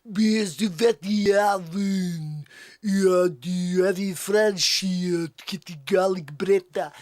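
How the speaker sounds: slow, hammed-up mock French accent. Comic who has never been to France, mock French accent. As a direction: babble in fake French. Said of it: "French accent".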